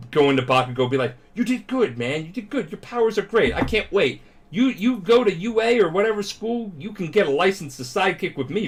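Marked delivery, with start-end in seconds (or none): 1.3-8.7 higher-pitched gushing; eager, fawning mock praise. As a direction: imitate an admiring crowd, pitched up. Acts crowd gushing over Bakugo.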